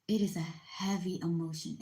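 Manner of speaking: ordinary talk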